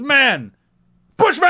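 high, loud proud reveal; proud exclamation. Retold or imitated. Co-host imitating Bushman revealing himself, proud high shout. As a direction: proclaim it high, full of showy pride. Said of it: "he's so proud of it".

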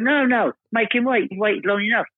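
high, lightly voiced impression of a big American star; high mock John Voight. [name] imitating John Voight telling Martin to let him keep rehearsing. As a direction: pitch up and play the star waving him on. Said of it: "he went".

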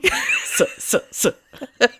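laughing stutter on a hissed S. Real, not acted. Podcaster stuck on a word, laughing stutter. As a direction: get stuck on the S and crack up. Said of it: "There goes that stutter".

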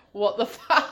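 laughing disbelief. Retold or imitated. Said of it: "'Cause it was just like".

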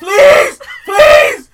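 loud high laughing please; shouted plea. Real, not acted. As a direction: yell please at her, half laughing. Begging friend not to cry.

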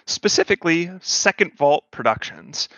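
usual speaking voice

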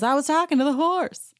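high, teasing Kyle correction; mock Kyle. Kyle telling Sutton she meant the horse, high and teasing. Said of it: "Kyle says".

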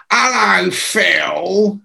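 stretched-out mock punk hello. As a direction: imitate a punk sauntering over to say hello. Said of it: "this bloke comes over and he goes".

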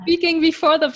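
words spoken through a laugh. Real, not acted. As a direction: chuckle your way through it. Laughing while introducing guest.

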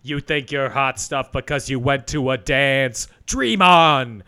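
loud, mean, theatrical sneer; jaded old Hollywood star. Retold or imitated. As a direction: sneer it like a faded diva. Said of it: "he sounds like a jaded old Hollywood star".

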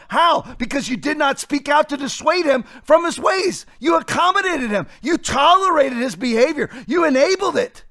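high, insistent sermon delivery; impassioned preaching. Acted. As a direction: drive each accusation home like a preacher. Preaches at full force.

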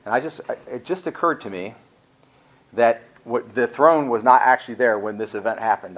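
usual speaking voice

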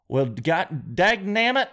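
put-on slow Southern accent drawl. Shopper in a small town store, in a Southern accent. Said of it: "talking in Southern accents".

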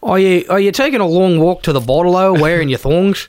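broad mock Aussie; hammed-up Aussie bloke; Australian accent. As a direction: lay on a thick Australian accent. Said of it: "doing Australian accents".

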